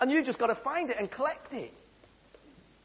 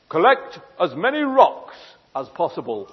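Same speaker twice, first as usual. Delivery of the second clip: slow, lower, grand pronouncement; solemn genie; 5 semitones lower, 6 dB louder than usual. Acted. Genie giving Jimmy his advice, slow and grand. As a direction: intone it like a mystic oracle. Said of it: "So the genie goes".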